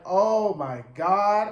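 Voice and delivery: slow, stretched, scandalized; slow gasp of disbelief; drawn-out oh my God. Retold or imitated. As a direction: draw every word out in disbelief. Reacting to Babe's refusal.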